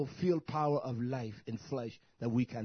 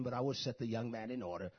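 ordinary talk

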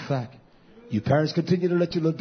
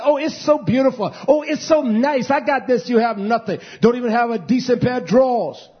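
higher, louder mock-boastful sing-song; 5 semitones higher, 5 dB louder than usual. Preacher mimicking the wicked to his flock, mocking. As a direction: sneer it out like a vain show-off.

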